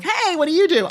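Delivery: high, gushing party hello; party greeting. Acted. Greeting someone met at a party, gushing and high. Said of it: "We're at a party. I'm like".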